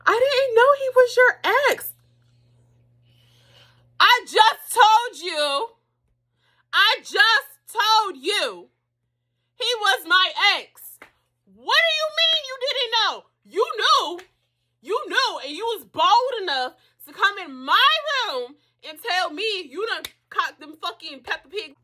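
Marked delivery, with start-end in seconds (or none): 0.1-1.9 high, put-on voice of the friend playing innocent; mocking her friend. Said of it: "she was just like". 4.0-17.5 much higher and louder, slow and drawn out; hammed-up indignant shouting back at a friend; mock outraged retort. Retold heated fight with friend.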